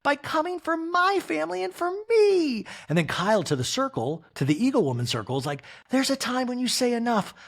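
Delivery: a hurt, high-pitched complaint; hammed-up Kyle impression; mock Kyle.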